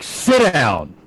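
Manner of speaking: half-shouted in-character command; yelled order. Acted. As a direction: order him down with a mild yell. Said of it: "I'm gonna yell at him".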